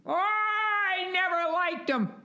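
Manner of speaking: put-on very high, slow, quavering old-lady gossip. Old villagers passing on Hitler gossip, in a very high slow old-lady voice. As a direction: squeak it out like a gossipy old neighbour, drawn out. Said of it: "The old people go".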